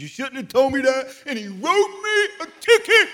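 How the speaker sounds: high, loud laughing delivery; laughing punchline. Real, not acted. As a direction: burst out laughing through the punchline. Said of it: "He goes".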